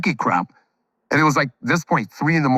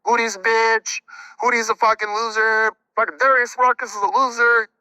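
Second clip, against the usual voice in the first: mock prank voicemail voice; 7 semitones higher than usual. Prank voicemail greeting he recorded.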